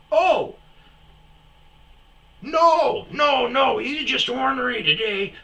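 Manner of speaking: very high, loud squawking dismissal; mimicked older woman. Wife excuses her husband to the storyteller, high mock-woman voice. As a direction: put on a shrieky old-lady falsetto.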